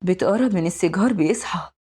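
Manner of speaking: ordinary delivery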